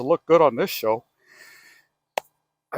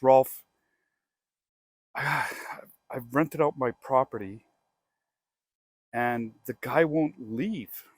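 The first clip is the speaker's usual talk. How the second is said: weary sigh; slower than usual. Retold or imitated. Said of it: "he's phoning and he goes".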